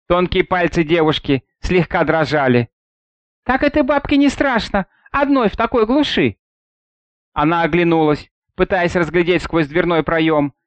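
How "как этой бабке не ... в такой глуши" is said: higher, lightly voiced girl; a hint of a young girl. A narrator's reading. Girl's thought voiced higher.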